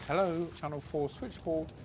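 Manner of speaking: a hint of a cheery London operator; switchboard operator. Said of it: "that beautiful estuary voice".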